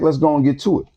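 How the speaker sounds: ordinary delivery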